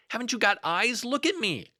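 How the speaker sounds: high, frantic shout. Acted. Piglet shouting at Kanga to notice him, high shout. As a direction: yell it at her, exasperated.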